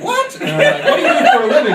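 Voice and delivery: can't believe what he heard; shocked disbelief. Retold or imitated. Told about the guy's Brazilian wax, high shocked squeak.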